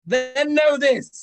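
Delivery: slow, high, loud decree; booming proclamation. Acted. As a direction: proclaim it like a god. Patron spirit proclaims.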